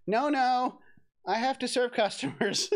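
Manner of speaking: high protest through laughter; laughing. Pretend. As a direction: protest while giggling.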